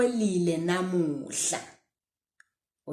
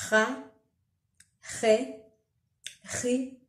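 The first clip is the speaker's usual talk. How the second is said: slower; 4 semitones higher, slower than usual. Shown as a demonstration. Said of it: "Slower this time".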